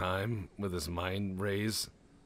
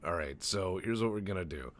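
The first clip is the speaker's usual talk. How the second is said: ordinary talk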